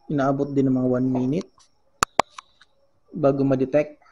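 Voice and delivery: usual speaking voice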